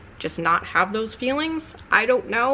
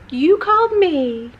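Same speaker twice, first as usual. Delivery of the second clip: much higher, slowed-down odd voice on "You called me."; weird voice; 10 semitones higher, 6 dB louder, slower than usual. Retold or imitated. Baffling the caller by repeating "You called me." in a weird high voice. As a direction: stretch "called" in a strange high voice. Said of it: "just purposely talking really weird".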